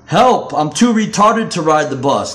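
high, loud jeering cry for help; mocking preps. Preps mocking the kid from the short bus, loud jeer. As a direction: taunt him with a fake plea. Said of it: "they joked".

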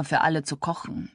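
usual speaking voice

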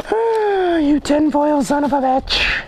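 high, drawn-out cursing cry; exasperated outburst. Real, not acted. Opener cursing at stubborn tinfoil, exasperated outburst. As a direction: curse the stubborn thing at a high pitch. Fighting tinfoil while opening a letter.

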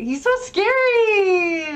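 high slow frightened whine; scared. Real, not acted. Gamer facing a creepy boss, high slow scared whine. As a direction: shrink from the monster on screen.

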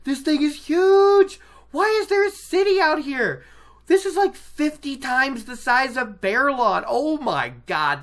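loud, high-pitched freak-out; hammed-up group panic. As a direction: exclaim in high, loud astonishment. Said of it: "they're all freaking out a little bit".

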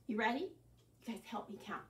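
normal talking voice

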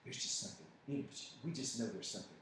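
soft, breathy mocking whisper; mock hushed gossip. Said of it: "They're like".

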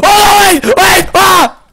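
high loud panicked yell; frantic shout as it blows up; panicked cry. Real, not acted. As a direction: shriek in real panic. Cupcake about to blow.

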